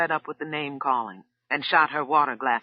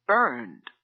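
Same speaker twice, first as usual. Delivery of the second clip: voice cracking; 3 semitones lower than usual. A narrator's reading. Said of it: "his voice cracked a little".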